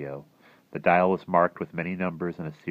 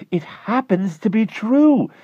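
much higher and louder, intense; tense high voice; 9 dB louder, slower than usual. Acted. Klausner confiding in his neighbor, feverish. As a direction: lean in with a feverish secret.

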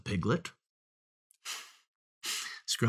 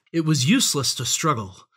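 usual speaking voice

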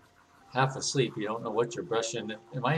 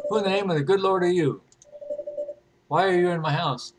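raised, higher-pitched outraged challenge; indignant homeowner; 4 semitones higher than usual. Acted. Startled homeowner confronts intruder in game.

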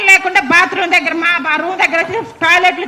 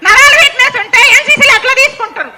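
angry accusation. Real, not acted. Confronting them over fake certificates, angry raised voice. Demanding answers, scornful.

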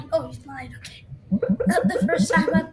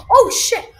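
loud startled swear; startled yell; 5 semitones higher, 9 dB louder than usual. Real, not acted. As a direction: blurt it out in fright. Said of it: "sorry, I swore".

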